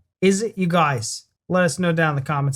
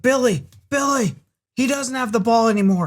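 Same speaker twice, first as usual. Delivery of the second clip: high, louder mock scolding; acted calling out; 8 semitones higher, 5 dB louder than usual. Bystander calling Billy off the player, acted shout. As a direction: call Billy off, high and urgent. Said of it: "He's like".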